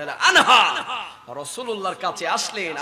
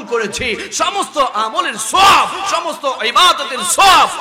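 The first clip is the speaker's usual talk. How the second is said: loud, raised preaching shout; impassioned vow; 5 semitones higher, 8 dB louder than usual. Retold or imitated. Preacher as Abu Bakr vowing to the Prophet, impassioned. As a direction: build to booming peaks on the key words.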